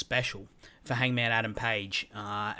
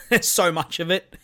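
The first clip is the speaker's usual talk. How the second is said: laughing; 6 semitones higher, 5 dB louder than usual. Real, not acted. Laughing at how much story there is.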